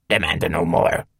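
distorted electronic command; ordering second voice. A narrator's reading. Glitching machine orders the intruders, hissing static. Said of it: "the second voice ordered".

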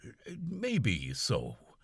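slow, wary valet voice; slow guarded answer; cautious. Acted. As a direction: hold back, don't commit. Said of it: "was the cautious reply".

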